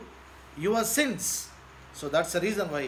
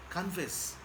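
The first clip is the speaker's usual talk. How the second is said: soft urging; 8 dB quieter than usual. Acted. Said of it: "come to him".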